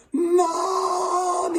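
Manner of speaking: very high, loud drawn-out call. A narrator's reading. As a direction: call out high and hard, stretching it. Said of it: "Luke said louder".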